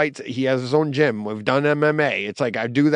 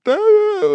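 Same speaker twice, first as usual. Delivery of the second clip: high strangled wail, much higher and louder; sobbing wail; 5 dB louder than usual. Retold or imitated. Driving to work, dreading the wedding: high crying wail. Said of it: "being like".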